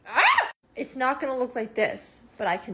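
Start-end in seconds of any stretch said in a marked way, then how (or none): 0.1-0.5 short, loud high "ah"; sudden piercing little cry; high yelp. Acted. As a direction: punch out a high sung yelp. Sung exclamation after bragging.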